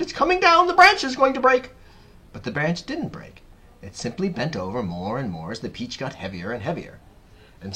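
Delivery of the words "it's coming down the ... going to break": high, loud alarmed shout; Aunt Sponge shouting. A narrator's reading. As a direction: shout a warning to get clear. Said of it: "Aunt Sponge shouted".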